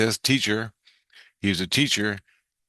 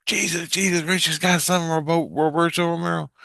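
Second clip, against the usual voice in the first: higher, urgent-child imitation; lightly voiced kid reporting; 8 semitones higher than usual. Kid tells Jesus what was overheard, lightly voiced high report. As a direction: lightly mimic a kid passing on news.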